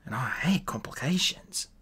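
whispered, breathy aside of irritation; muttered under his breath. A narrator's reading. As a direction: hiss it quietly to yourself, annoyed. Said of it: "Dee muttered".

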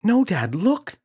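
put-on high, loud eager child voice; a boy bursting to show his dad; excited. Boy urging his father to look, excited. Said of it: "said James excitedly".

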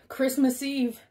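plain reading voice

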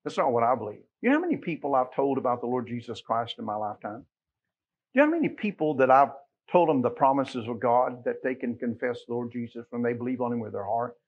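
ordinary delivery

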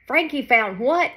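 shocked gasped question; gasping in surprise. A narrator's reading. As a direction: gasp it out, startled. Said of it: "gasped a deer".

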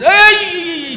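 high loud yelp of pain; pain cry. Acted. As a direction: cry out as the sore spot is knocked. Old man touched, cries out.

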